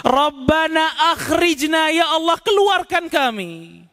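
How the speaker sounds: high crying shout, slow and drawn out; screaming to God. Acted. The damned crying to God from hell, anguished shout. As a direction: howl from the fire to be let out. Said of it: "Mereka teriak kepada Allah".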